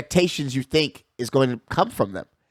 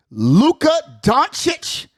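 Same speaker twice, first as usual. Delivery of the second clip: hype name call; 10 semitones higher, 3 dB louder, much slower than usual. Acted. Host hyping up Luka to his listeners, slow high name call.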